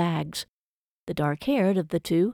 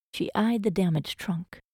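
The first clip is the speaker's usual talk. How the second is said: ordinary delivery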